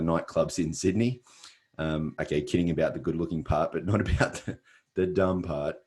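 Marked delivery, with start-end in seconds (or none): none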